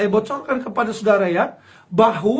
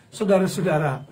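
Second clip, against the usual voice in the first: ordinary talk, nothing marked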